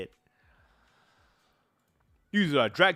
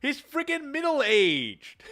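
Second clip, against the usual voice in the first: incredulous exclaim; 10 semitones higher, slower than usual. Real, not acted. Exclaiming in disbelief.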